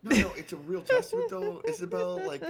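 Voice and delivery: high and loud emphatic praise; excited. Real, not acted. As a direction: gush it out high.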